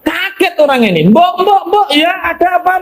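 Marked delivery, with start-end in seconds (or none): 1.1-2.8 memanggil panik. Acted. Berteriak memanggil mbok.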